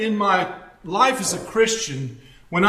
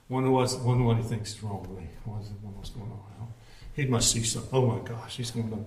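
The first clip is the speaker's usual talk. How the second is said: anxious inner voice; 10 semitones lower, 12 dB quieter, faster than usual. Retold or imitated. Voicing the churchgoers' worried thoughts.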